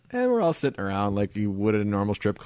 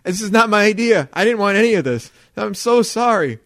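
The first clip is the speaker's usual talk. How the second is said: loud, higher mock pleading; retold frantic excuse; desperate apology; 8 semitones higher, 7 dB louder than usual. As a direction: protest innocence to an angry partner. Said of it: "he's staring at Jill like".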